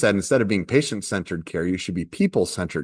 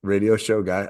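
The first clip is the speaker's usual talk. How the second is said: ordinary delivery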